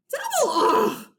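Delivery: very high, hammed-up ugh; kid's over-the-top revulsion; disgusted groan. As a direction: spit out the disgust. Disgust at firsties.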